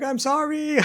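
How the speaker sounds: very high, loud mock wail; hammed-up squealed remorse; wailing apology. Mother-in-law apologises to the snake, hammed-up wail.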